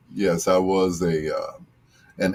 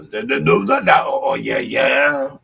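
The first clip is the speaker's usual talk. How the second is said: [name] scat; 6 semitones higher than usual. Acted. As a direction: belt out a jazzy da-da-da and a big yeah. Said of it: "sounding like [name]".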